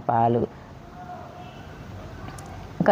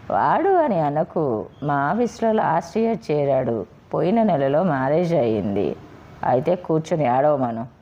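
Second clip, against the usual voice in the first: ordinary delivery, nothing marked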